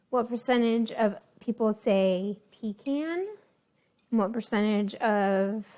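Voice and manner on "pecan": "pecan" said PEE-CAN, can as in tin can; pee-can pronunciation. Shown as a demonstration. Asking what share of people say it, "pecan" as pee-can. Say it with the con.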